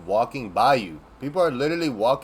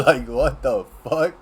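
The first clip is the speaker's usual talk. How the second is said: laughing; 3 semitones higher, 6 dB louder, slower than usual. Real, not acted. Laughs in disbelief.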